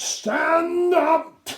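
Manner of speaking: high, loud command; stern captain roaring; angry shout. A narrator's reading. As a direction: bark the order like a drill sergeant.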